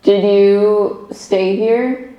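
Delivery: ordinary delivery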